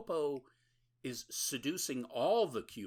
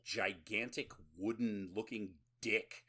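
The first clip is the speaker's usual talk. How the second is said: ordinary talk, nothing marked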